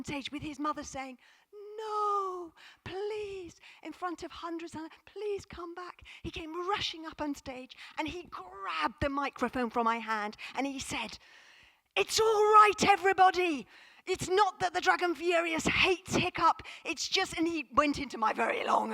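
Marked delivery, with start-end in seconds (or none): 1.8-3.6 high, pleading mother's cry; mother calling her son back. Retold or imitated. 5.0-6.0 pleading mother. Retold or imitated. 11.9-17.4 put-on high and loud, a boy reassuring the crowd; little boy on stage calming the audience, loud and high; excited boy announcing.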